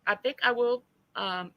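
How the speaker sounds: ordinary delivery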